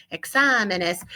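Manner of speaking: exámenes said as ek-SA-men-es; stress on SA. Shown as a demonstration. Teacher keeps plural sounding like singular, stress on SA. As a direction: keep the weight on the second syllable of exámenes. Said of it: "keep the word sounding just like examen".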